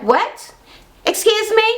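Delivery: high-pitched mock indignation; retold, high-pitched "how dare you" reaction; offended disbelief. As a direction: act out an affronted comeback. Acted offended reply in retold argument.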